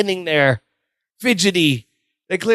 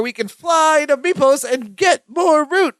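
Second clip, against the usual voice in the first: excited plan; 6 semitones higher, slower than usual. Retold or imitated.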